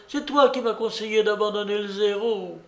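high-pitched reproach; accusing old lady. A narrator's reading. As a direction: lay the blame in an old lady's high voice. Upset old woman blames her adviser. (reproche de vieille dame, voix aiguë)

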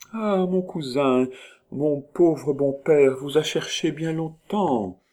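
Marguerite behind her mask mimics another woman, high and slow; imitated woman's voice. A narrator's reading. Put on a stranger's sweet high voice, slowly. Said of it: "Une voix douce et grave, mais musicale comme un chant".

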